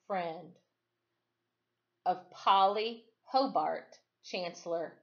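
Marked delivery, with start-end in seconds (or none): none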